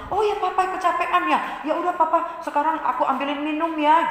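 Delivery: put-on high, sweet child's voice; gentle, understanding child. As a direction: soothe tired papa eagerly and kindly. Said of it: "Sikap yang lemah lembut".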